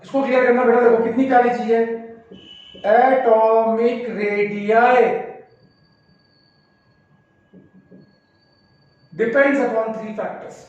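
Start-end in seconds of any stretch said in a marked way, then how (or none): 2.9-10.6 words drawn out for writing; slow dictation. Shown as a demonstration. Teacher to students, dictating the factors slowly. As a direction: dictate the rule at writing speed.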